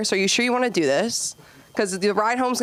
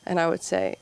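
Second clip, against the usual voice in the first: ordinary delivery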